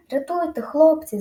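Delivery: desperate bawl for rescue; yelling. A narrator's reading. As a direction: scream to the boys from the water. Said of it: "зарепетувала голова".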